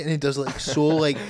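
ordinary talk, nothing marked

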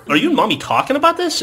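high, loud, laughing disbelief; incredulous dad. Retold or imitated. Dad questioning his young daughter, incredulous.

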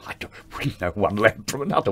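breathy whispered aside; retold whisper. Director admitting he can't light a show, whispered. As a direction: whisper it like a confession.

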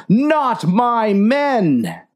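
high, slow drawn-out cry of grief; slow anguished yell. Acted. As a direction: stretch the cry over the fallen. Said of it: "yelled the captain".

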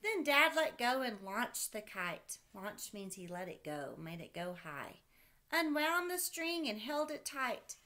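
put-on lively read-aloud voice for a child; storybook reading. Parent reading a kite story to a child, lively read-aloud. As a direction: read it like a bedtime picture book.

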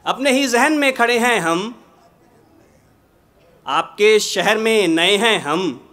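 measured, projected ghazal recitation with pauses; poetry recitation. Acted. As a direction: recite the couplet to a mushaira audience.

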